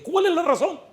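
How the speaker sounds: very high, loud demanding question; piercing question. Retold or imitated. Preacher demanding the reason from the woman, very high and loud. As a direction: cry out the question, high and sharp.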